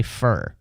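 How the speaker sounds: "fur", short F E R vowel; reduced pronunciation. Shown as a demonstration. Showing how he says it mid-sentence, reduced "fur". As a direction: say "fur" to rhyme with her. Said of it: "Like F E R".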